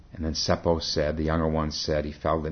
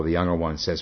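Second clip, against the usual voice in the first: ordinary talk, nothing marked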